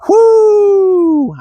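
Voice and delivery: very high thrilled whoop; airborne whoo. Retold or imitated. Kid launched off the back seat over the culvert, very high whoop. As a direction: let it fly up as you leave the seat. Car hits the culvert jump.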